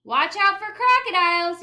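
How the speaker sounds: high, loud call across the creek; calling back. Acted. Teacher calling to child on the rocks, loud. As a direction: call out a warning from afar. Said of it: "you called back".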